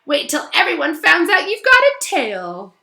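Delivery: high, loud gleeful taunt; gloating Myrtle. Acted. Myrtle taunts Hermione about her tail, high and gleeful. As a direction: tease with ghostly glee. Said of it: "half-guilty".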